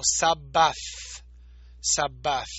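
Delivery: Sabbath with an s sound, final th dropped; Greek transliteration. Shown as a demonstration. Teacher shows the Greek s in Sabbath. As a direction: Sabbath said with s, sharp and clear. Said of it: "transliterada como".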